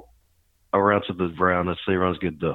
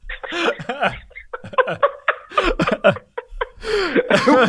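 laughing hard, very high; high-pitched laugh. Real, not acted. Teller laughs at own joke.

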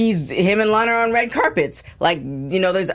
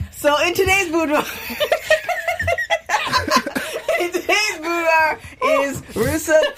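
laughing; 9 semitones higher than usual. Real, not acted. Laughing through her segment intro.

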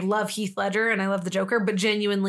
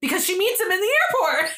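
much higher, louder insisting; defensive self-quote; 10 semitones higher, 4 dB louder than usual. Retold or imitated. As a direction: squeak it out as the obvious answer. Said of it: "I was like".

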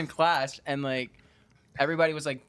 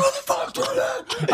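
hammed-up high-pitched tantrum; freaking out; slower than usual. Retelling a huge classroom scene to friends, mock freak-out. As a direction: shriek in pretend outrage. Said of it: "I'm freaking out".

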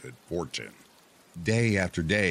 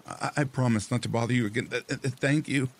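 fast, higher flustered thanks; nervous stammer; 6 semitones higher, faster than usual. Acted. Fisherman nervous, hurried.

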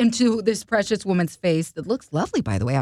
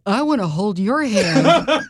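slow, laughing mock fan quote; 4 dB louder, slower than usual. Starstruck fan blurting a Beatles title, laughing.

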